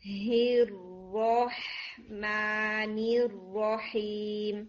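very slow الرحمن الرحیم, ha breathed out; recited with strong ha. Shown as a demonstration. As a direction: chant it slowly, airy ha. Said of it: "پھر اس کو را کے ساتھ ملائیں".

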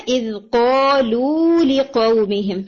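slow recitation of اِذْ قَالُوا لِقَوْمِهِمْ with the waw of قَالُوا held long; Quran recitation demo. Shown as a demonstration. Tajweed teacher showing a student the long waw, slow recitation. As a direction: recite it slowly, drawing out the waw. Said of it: "یہ واو مدی آپ کا short ہو گیا تھا".

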